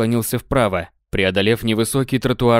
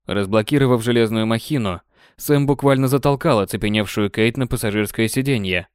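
usual speaking voice

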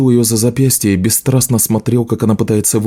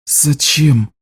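hoarse, higher-pitched question; 5 semitones higher than usual. A narrator's reading. Disappointed healer asks the juggler why, hoarsely. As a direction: ask hoarsely, hurt by the betrayal. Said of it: "сипло спросила Лиша".

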